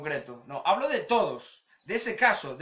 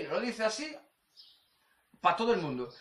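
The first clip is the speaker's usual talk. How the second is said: ordinary delivery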